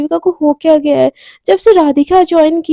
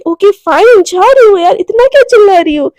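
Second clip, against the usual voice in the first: louder and higher, fed up and snapping back; annoyed outburst; 4 semitones higher, 5 dB louder than usual. Acted. Radhika answers Devika's scolding, irritated and loud. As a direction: throw it back at her, exasperated.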